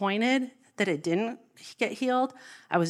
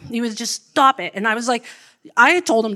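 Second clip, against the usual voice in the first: ordinary delivery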